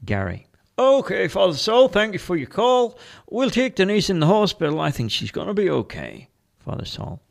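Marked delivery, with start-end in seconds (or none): 0.8-6.3 high, louder officer voice; brisk officer wrapping up a call; police officer. Acted. Officer thanking the priest for his call, brisk high voice. Officer reports to Father Saul.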